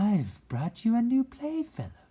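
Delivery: high, loud, bright fairy voice; high sweet fairy speaking to the rabbit. A narrator's reading. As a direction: lift it way up into a fairy's voice. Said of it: "said the fairy".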